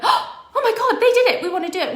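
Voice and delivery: high, loud gasping thrill with a laugh; imitating bystanders bursting with excitement; excited disbelief. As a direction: squeal it like they just saw the impossible. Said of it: "in that excited energy".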